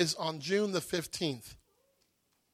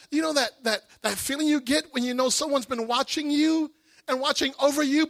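usual speaking voice

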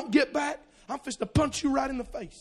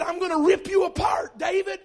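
mock Goliath threat; 7 semitones higher, 5 dB louder than usual.

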